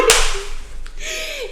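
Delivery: shrill cackle mid-rant; high, scornful laugh at herself; high mocking laugh. Laugh inside self-scolding.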